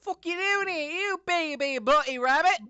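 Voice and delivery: British rabbit. Acted. British accent, rabbit picking a fight with the party. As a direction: snarl like a tiny British thug bunny. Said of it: "Went British again".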